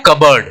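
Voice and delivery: "कबर्ड" as kub-erd, the p silent; correct contracted pronunciation. Shown as a demonstration. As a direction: join "कबर्ड" with a short second vowel. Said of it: "इसको क्या बोलते हैं". ("कबर्ड" में प की आवाज़ नहीं)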